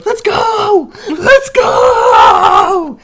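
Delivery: full-throated football war cry; high, roaring hype shout, last word stretched; hyped player yells. Retold or imitated. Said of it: "a minute of people going".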